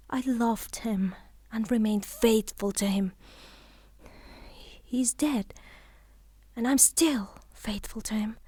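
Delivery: hushed, devoted vow; whisper. Acted. Widow whispers her lasting loyalty to her dead husband.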